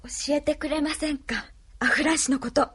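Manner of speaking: a reluctant, humbled plea; meek polite request. Acted. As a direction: swallow pride and ask nicely. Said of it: "それが人に物を頼む言い方かい？".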